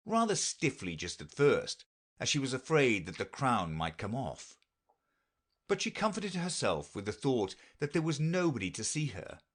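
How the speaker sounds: ordinary delivery, nothing marked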